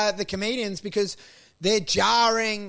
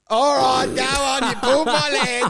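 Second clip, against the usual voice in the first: raised, louder performer's call; mock stage holler; 4 semitones higher, 5 dB louder than usual. Mimicking a performer giving in to the crowd, mock stage holler.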